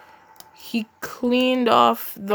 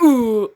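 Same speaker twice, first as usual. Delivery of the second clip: mock pained yelp; 3 semitones higher, 7 dB louder than usual. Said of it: "he was like".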